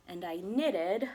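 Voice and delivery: ordinary delivery, nothing marked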